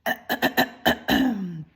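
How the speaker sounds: king clears his throat before singing; a royal throat clear; king clears throat before his song, deliberate. Acted. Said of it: "He cleared his throat".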